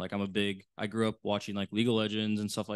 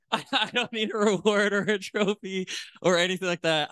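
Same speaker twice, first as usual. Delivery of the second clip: laughing; 12 semitones higher, 5 dB louder than usual. Real, not acted. Laughing after swearing on mic.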